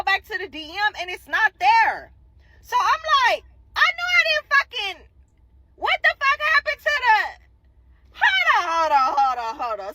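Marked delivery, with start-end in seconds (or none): 3.8-7.2 high, frantic panic over a lost message; much higher, cursing in a frantic rush; panicked disbelief. Retold or imitated. 8.2-9.9 crying-out stop everything; panicked shout. Retold or imitated. Panics over a missing DM.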